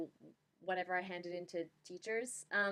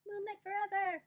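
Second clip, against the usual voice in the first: fangirl cheer; 13 semitones higher than usual. Acted. Fangirl cheering the band name.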